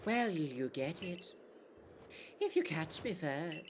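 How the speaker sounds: mildly teasing, coy character voice. Acted. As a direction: dangle it as a playful challenge. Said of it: "She's very sultry".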